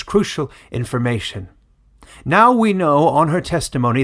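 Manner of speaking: put-on loud, grave old village elder addressing the crowd; solemn elder's address. Old elder announcing the verdict to villagers, loud and grave. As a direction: proclaim it like a weary old elder passing judgment. Elder defends her testimony.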